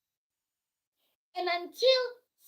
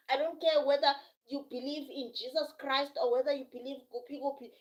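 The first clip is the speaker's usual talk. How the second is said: ordinary talk, nothing marked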